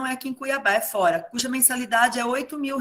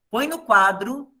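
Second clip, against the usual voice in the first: ordinary talk, nothing marked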